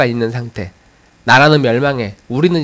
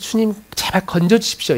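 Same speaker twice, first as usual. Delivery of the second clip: higher-pitched mild plea; pleading petition; 7 semitones higher, 4 dB quieter than usual. Acted. Psalmist crying to the Lord for rescue, acted plea. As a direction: beg gently for rescue. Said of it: "이런 탄원하는 내용들이".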